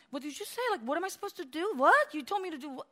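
mock indignant high disbelief; upset deacon. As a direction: play the offended leader. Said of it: "They get upset like".

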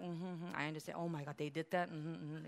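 soft listening murmur. Retold or imitated. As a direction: murmur along like a patient listener. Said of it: "She's like".

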